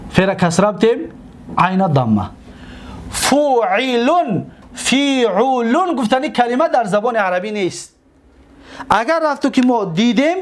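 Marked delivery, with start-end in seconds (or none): 3.1-5.9 فوعلون slow, each vowel held long; drawn-out demonstration. Shown as a demonstration. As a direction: stretch فوعلون out as fu, i, lun. Teacher models pattern slowly.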